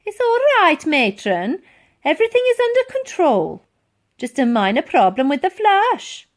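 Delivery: high loud falsely cheerful call; boy calling to Matron to hide the mess. Acted. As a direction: call out through the door to cover up. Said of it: "he raised his voice".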